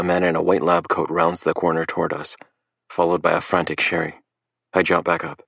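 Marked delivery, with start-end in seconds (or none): none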